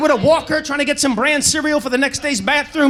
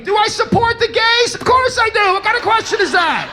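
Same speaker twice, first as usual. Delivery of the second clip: mock offended shouting; indignant mishearing; 9 semitones higher than usual. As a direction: bristle at the misheard question. Mishearing the cashier.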